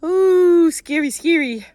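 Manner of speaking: high hammed-up ooh, spooky; mock spooky. Teasing viewers about her dark mind, mock-spooky. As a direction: play at being scared, tongue in cheek.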